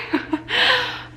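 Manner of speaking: a high, breaking laugh while fighting tears; tearful laugh. Real, not acted. She laughs through tears while telling viewers about her channel.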